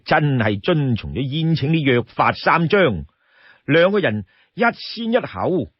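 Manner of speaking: usual speaking voice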